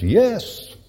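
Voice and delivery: otherworldly voice. Retold or imitated. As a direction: answer like a voice from heaven. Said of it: "this otherworldly voice comes back".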